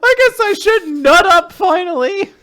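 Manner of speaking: a mock-brave squeak; squeaky hero. Cohost joins the squeaky Gakuro voice.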